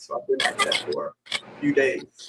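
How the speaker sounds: raspy, half-voiced whisper; hoarse breathy voice, little tone; papery whisper. Real, not acted. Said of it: "Excuse my raspy voice".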